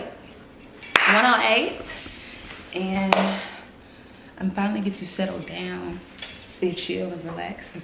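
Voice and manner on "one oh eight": slow, drawn-out sing-song; drawn-out repeat. Real, not acted. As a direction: stretch the words slowly.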